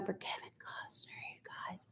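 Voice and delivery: breath-only confiding aside; hushed swooning. Real, not acted. Confessing a crush to viewers, hushed whisper. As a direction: drop into a near whisper.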